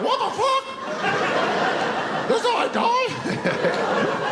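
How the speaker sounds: very high, squeaky, slow bewildered chicken; panicked chicken voice. Retold or imitated. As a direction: squeal it like a shocked chicken about to die. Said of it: "You should have heard, like".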